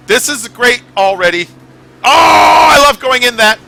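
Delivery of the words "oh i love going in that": much higher, loud delight; excited shout. Real, not acted. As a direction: yell with joy at the turn. Excited gaming moment.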